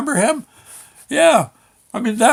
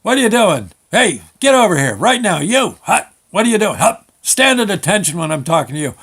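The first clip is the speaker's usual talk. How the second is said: raised, barked drill-sergeant orders; imitated yelling; 4 semitones higher, 3 dB louder than usual. DMV worker orders a teenager around, imitated yelling. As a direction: bark commands like a mock drill instructor. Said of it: "they yelled at you like".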